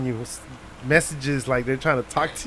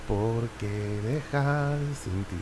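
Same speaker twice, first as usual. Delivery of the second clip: slow, lower, soft sung line; 7 semitones lower, 10 dB quieter, much slower than usual. Shown as a demonstration. Showing how he'll lip-sing the ballad, low and slow. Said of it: "Like, in a low voice".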